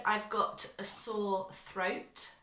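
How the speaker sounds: ordinary talk, nothing marked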